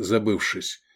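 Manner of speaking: plain reading voice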